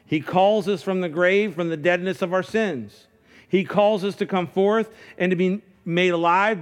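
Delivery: full-force preacher voice; fervent preaching. Acted. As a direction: preach it with rising fire.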